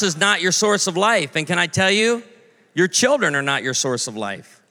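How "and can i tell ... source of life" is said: ordinary delivery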